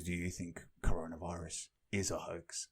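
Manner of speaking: quiet mock reporter asking a passerby; mock interviewer question. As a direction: ask it low and quiet like a reporter. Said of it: "he goes".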